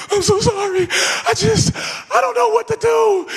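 very high, pleading and rattled; panicked apology. Retold or imitated. Scared teen begging the officer, very high pitch.

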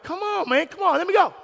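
high, pleading, whiny; mock impatient plea. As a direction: beg to be let through. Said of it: "I was like".

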